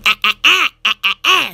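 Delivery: imitating a squeaky noise in staccato ahs; washroom noise. Telling viewers how the washroom sounds, mock squeaky ahs. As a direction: mimic a washroom's repeated ah sound. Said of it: "My washroom goes".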